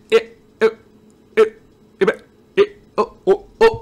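lag stutter. Retold or imitated. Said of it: "And then I join, I'm like".

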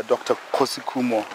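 normal talking voice

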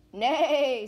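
loud, drawn-out imitated neigh; horse neigh. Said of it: "said a horse".

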